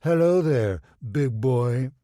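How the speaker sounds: teasing imitation of a flirty greeting; mocking flirt. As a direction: camp it up to mock him. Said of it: "to mirror Royce's earlier pose".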